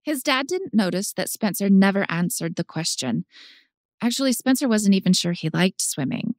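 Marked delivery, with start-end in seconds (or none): none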